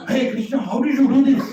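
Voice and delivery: high, loud incredulous question; astonished exclamation. Acted. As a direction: cry out in acted amazement. Gopi astonished to find Krishna.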